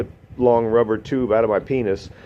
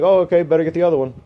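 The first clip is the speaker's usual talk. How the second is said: imitating his detached self; 6 semitones higher, faster than usual.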